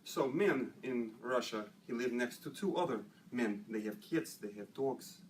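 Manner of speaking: low-pitched Russian joke-teller; Russian accent. Shown as a demonstration. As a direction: tell it in broken English with a Russian accent. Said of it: "I got a Russian".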